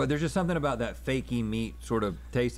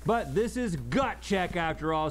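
ordinary delivery

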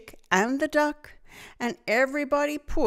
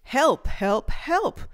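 crying for help; 5 semitones higher, slower than usual. A narrator's reading. The little truck stuck in mud, calling help. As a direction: call out help, getting more desperate. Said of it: "cried the little blue truck".

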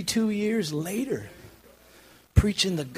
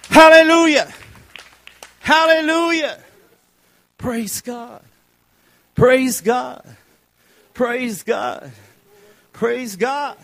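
loud, high, slow preacher's cry; shouted testimony building and then easing off; full-force preaching; 7 semitones higher, 14 dB louder than usual. Acted. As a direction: drive it home at full volume.

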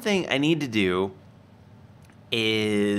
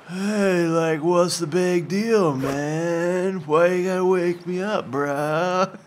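surfer dude voice; 4 semitones higher, slower than usual. Acted. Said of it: "make him sound like a surfer".

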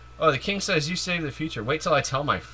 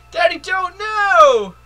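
high, slow wailing shout; high pleading child shout; childish cry; 9 dB louder, slower than usual. Acted. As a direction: cry out like a desperate little girl. Voicing a child pleading in cutscene.

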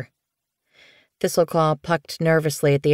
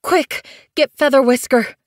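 high, loud, breathless urgency; gasped urgent plea; 9 semitones higher, 5 dB louder than usual. Acted. Bluefur gasping for the medicine cat, high and urgent. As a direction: gasp it out in a panic. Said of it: "Bluefur gasped".

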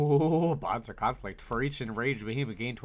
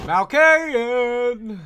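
shout; 12 semitones higher, 14 dB louder, much slower than usual. Real, not acted. Calling out loudly in game.

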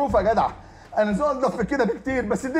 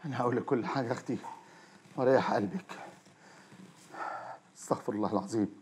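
sheepish and subdued; 10 semitones lower, 10 dB quieter than usual. Acted. Shafei calming his angry sister, sheepish and subdued. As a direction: lower and much softer, trying to smooth it over.

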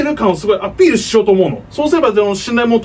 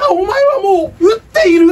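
slow shouted Hokuto catchphrase; Kenshiro impression; 8 semitones higher, slower than usual. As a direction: growl it like a martial arts hero.

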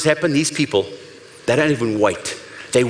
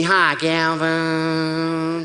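slow drawn-out American "Hi, Gavin"; American accent; 2 semitones higher, much slower than usual. Retold or imitated. Speaker imitates American fan greeting him, slow American accent. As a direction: stretch it out in a syrupy American accent. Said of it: "Don't you love that American accent?".

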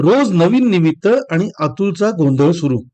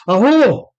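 wife yells for husband; shout; 5 semitones higher, much slower than usual. A narrator's reading. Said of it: "ती ओरडली".

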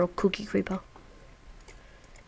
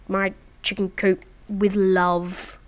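slow, each word pressed, leaning on with; slow emphatic; slower than usual. Real, not acted. Telling viewers the coop was built with love, slow and proud. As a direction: lay it on slowly and proudly. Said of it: "Yeah, you heard me".

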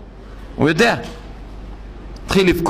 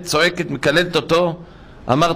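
ordinary delivery

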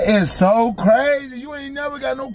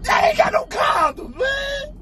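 mock sobbing; 12 semitones higher than usual. Boyfriend fake-sobbing complaint.

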